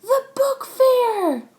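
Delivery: high, slow, loud cheer; excited cry together. Acted. The class cheers at the news, high and stretched. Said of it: "we all said together".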